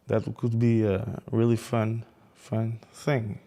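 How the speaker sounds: ordinary talk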